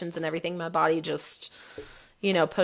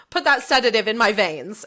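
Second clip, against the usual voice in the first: louder, pressing demand for the sedative; urgent demand; 3 semitones higher, 5 dB louder than usual. Retold or imitated. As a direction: insist loudly, half laughing at herself. Demanding sedative during surgery.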